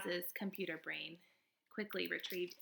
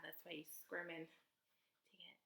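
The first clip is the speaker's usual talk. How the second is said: whispering; 3 semitones lower, 14 dB quieter, slower than usual. Real, not acted. Puppy squirming on lap.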